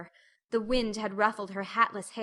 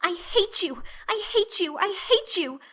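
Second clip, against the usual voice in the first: higher and louder, stamping each time; furious repeated outburst; 10 semitones higher, 4 dB louder than usual. A narrator's reading. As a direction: hammer the hatred home, each louder. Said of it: "A louder stamp with each assertion".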